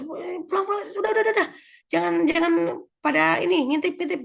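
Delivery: high-pitched imitated teacher shooing kids; shooing away. Teacher sending peeking children away from the window, shooing. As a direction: chase them off, mock-fussy. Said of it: "Sampai diusir".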